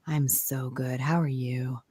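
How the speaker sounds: playfully sultry, low and soft; phone sex voice. Retold or imitated. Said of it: "Your phone sex friend".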